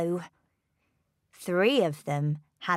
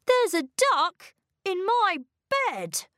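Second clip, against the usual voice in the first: put-on boy's shout; 12 semitones higher than usual. A narrator's reading. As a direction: pipe up in childish protest. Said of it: "shouted William".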